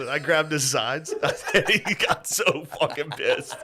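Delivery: amused telling, breaking into laughter; laughing. Real, not acted. Son recounting a prank on dad, laughing. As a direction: laugh through the words.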